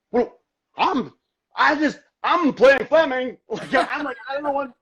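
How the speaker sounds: loud, high, halting mock stammer; flustered stammer. Put on the spot by the director, flustered stammer.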